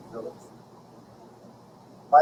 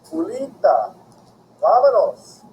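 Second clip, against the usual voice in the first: calling across a parking lot, higher and louder; father calling out; 9 semitones higher, 8 dB louder, slower than usual. Retold or imitated. Father calling his daughter home across the parking lot, loud. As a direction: call her name out loud across the lot. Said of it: "my father's voice calling out across the parking lot".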